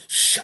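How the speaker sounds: hissed "shh" whoosh; imitated air rush. Drummer mimicking the reversed crash, imitated air rush. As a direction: imitate a sound sucking air out. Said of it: "like, sucking air out".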